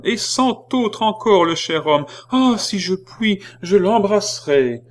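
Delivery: high, loud excited woman; a woman in the crowd cheering the cardinal; crying out. Acted. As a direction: shout it joyfully to the street. Same woman continues.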